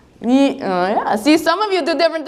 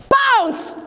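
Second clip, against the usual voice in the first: sudden pounce; 10 semitones higher than usual. Acted.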